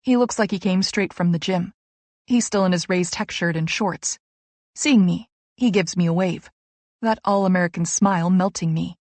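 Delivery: usual speaking voice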